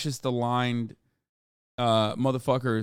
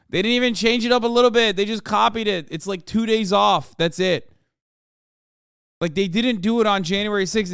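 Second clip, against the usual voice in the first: exasperated; 6 semitones higher, 5 dB louder than usual. Real, not acted. Exasperated rant about copying.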